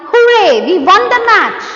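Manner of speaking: joyful, high, loud cheer; thrilled shout of victory; excited exclamation. Shown as a demonstration. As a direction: cheer it out like you just won. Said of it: "When you say that sentence with expression only".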